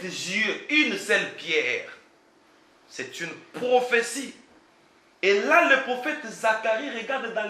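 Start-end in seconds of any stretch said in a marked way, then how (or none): none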